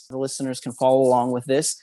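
ordinary delivery

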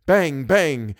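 usual speaking voice

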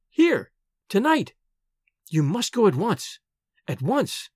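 urgent frightened heroine; hurried, eager warning. A narrator's reading. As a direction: warn him to flee, frightened. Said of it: "She spoke hurriedly, eagerly".

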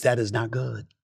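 low, soft final verdict; subdued verdict. Real, not acted. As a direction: drop the voice low and quiet. Repeats verdict, raised then dropped.

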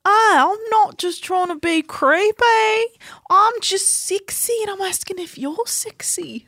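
slow, sleazy, breathy come-on; creepy flirt voice. Retold or imitated. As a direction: play a creepy seducer. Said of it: "what he's always saying".

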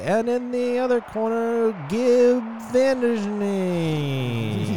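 high, loud ringside call, names stretched out; arena announcer. Acted. Announcer introducing the first fighter to the arena, booming and drawn out. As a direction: draw out the fighter's name like a boxing announcer. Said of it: "from the announcers you hear".

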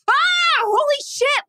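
high startled shriek; shout. Retold or imitated. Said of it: "And I go".

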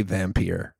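"vampire" said low and slow as vam-PEER; "vampire" rhymed with "peer", calmly proposed; vam-PEER pronunciation. Shown as a demonstration. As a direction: end "vampire" on "peer" instead of "pyre". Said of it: "whenever we say the word vampire from now on".

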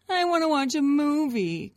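high, loud begging whine; whiny child. A narrator's reading. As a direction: whine like a kid pleading for a movie. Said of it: "Grace whined".

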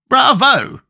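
high, bright exclamation; cried out. A narrator's reading. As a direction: call out Bravo with delight. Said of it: "cried Nick Chopper".

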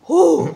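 loud, high "whoo"; whoo of relief. Shown as a demonstration. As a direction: let out a big whoo of release. Imitates relief of laughing through pain.

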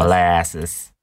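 "Molasses" said in a Boston accent. Shown as a demonstration. Host answers how Boston says molasses, Boston accent. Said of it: "how would they say molasses".

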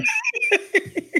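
a burst of high-pitched laughter. Real, not acted. Mother laughing at her own family joke, high laugh.

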